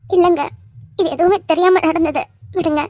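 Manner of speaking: loud, choked up; tearful. Acted. Wife consoling the weeping father, tearful. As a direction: comfort him through tears.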